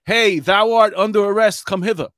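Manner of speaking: a cop playing a Shakespeare actor for a laugh; mock Shakespearean cop. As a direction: ye olde arrest, grandly overdone. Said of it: "What am I gonna be like?".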